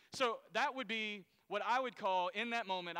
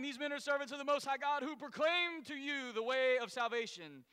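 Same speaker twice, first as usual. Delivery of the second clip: raised, loud proclaiming call; shouting; 6 semitones higher, 4 dB louder than usual. Retold or imitated. Slave girl following Paul, raised calling voice. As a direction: call it out to the whole crowd. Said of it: "so she's shouting".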